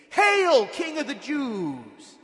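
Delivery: loud, high, drawn-out mock salute; big ringing shout of false homage; mocking hail. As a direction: shout it slowly like jeering soldiers. Soldiers mocking Jesus with a salute.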